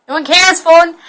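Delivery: loud exasperated yell at the phone; scolding shout. Real, not acted. Vlogger yelling at her buzzing phone, loud and fed up. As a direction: shout down a pointless alert.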